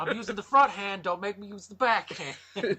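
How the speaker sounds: high-pitched, laughing mock bully; mock threat. Host jokingly threatening a slap, mock yelling. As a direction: threaten playfully through a laugh. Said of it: "yelling at people like".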